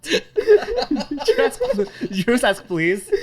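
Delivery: high, loud laughing question; laughing disbelief. Real, not acted.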